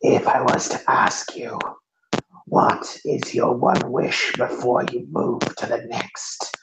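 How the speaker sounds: hushed, mostly voiceless rasp; rasping figure. Acted. Figure offers a dead man one wish, in a hushed rasp. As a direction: rasp softly, like needles on a chalkboard. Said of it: "rasping voice that sounds like needles on".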